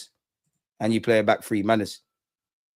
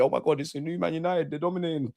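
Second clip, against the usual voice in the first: mock United fan; 4 semitones higher, 6 dB quieter than usual. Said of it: "I know you lot thought".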